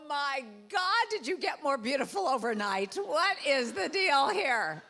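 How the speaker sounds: mock-vain diva gasping at herself; vain mirror gush. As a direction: swoon at your own reflection. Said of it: "looked at yourself in the mirror and went".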